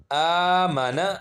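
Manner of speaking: drawn-out آمانا with long first آ; slow blended reading. Shown as a demonstration. Teacher reading a Qaida word to students, slowly blended. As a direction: stretch the آ in آمانا, keep ما and نا short. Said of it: "آگے ہے نون زبر نا".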